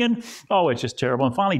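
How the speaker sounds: ordinary delivery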